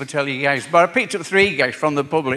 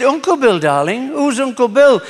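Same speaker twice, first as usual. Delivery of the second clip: put-on a lightly raised little girl's voice on the phone; child voice; 9 semitones higher, 5 dB louder than usual. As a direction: play the small daughter, a touch higher. Phone call, little girl voiced.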